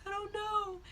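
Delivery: high, whiny mock sob; re-enacted tearful helplessness; imitated crying. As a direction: whine it through pretend tears. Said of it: "I burst out and started crying".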